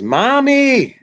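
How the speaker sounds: put-on high, loud child's call for Mommy. Olivia shouting for her mother over the lights. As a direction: shout it up like a tangled-up kid. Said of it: "she says".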